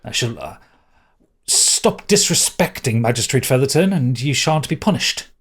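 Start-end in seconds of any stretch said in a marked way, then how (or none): 1.5-5.3 lightly voiced prince, slightly raised; haughty prince. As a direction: issue a haughty demand.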